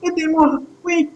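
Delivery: put-on high child's voice calling for help; frightened caged child. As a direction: plead haltingly, like a small captive child. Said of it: "Vous entendez une voix". (voix aiguë d'enfant captif qui appelle à l'aide)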